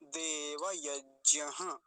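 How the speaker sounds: देवयज्ञः very slow, closing on an echoed ha; with visarga. Shown as a demonstration. Teacher answering "what will we say", slow visarga. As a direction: draw out देवयज्ञः and finish with ha. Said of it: "विसर्ग भी है साथ में".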